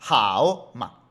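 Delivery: loud HAO dipping and rising, then short "ma"; disjointed "hao, ma"; full tones, unchanged. Shown as a demonstration. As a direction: overdo the full dip on "hao". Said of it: "no suena bien".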